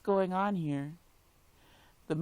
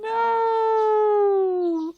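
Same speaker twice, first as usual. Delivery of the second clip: high, loud no; anguished cry; 7 dB louder than usual. Real, not acted. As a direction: cry out in protest. Last cry over Marco.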